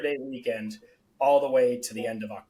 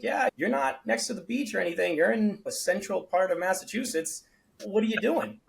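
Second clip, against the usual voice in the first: higher mock voice of skeptical shopkeepers; voicing other store owners; 7 semitones higher than usual. Shopkeepers mock the founder for being inland, higher voice. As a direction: pitch it up like a doubtful clerk.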